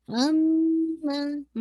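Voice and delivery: अम्मा with the double m held nasally for two counts; full nasal ghunna. Shown as a demonstration. Teacher holding अम्मा's nasal ghunna for student. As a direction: hum the mm in अम्मा through the nose. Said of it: "nasal cavity से अदा कर रहे हैं".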